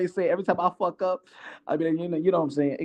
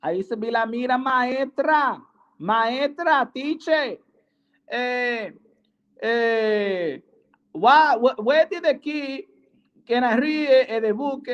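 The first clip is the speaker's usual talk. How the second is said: put-on higher, halting Spanish-accented pupil; mock Spanish accent; 4 semitones higher, slower than usual. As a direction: call on the teacher in hesitant, put-on Spanglish. Acts pupil calling the teacher.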